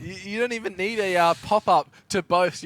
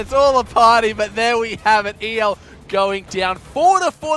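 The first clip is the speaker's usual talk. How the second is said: loud, excited match call; shouting commentary; 3 semitones higher, 11 dB louder than usual. Real, not acted. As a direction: project the result over a shouting room. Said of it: "everyone in the control room shouting".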